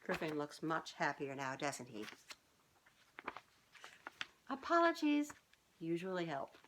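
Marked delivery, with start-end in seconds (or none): none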